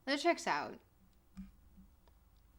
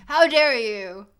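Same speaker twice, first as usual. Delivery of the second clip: theatrical outrage; 3 semitones higher, 9 dB louder than usual. Pretend. Streamer scolding the game like an offended noble, hammed up. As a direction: play a wounded noble taking offense.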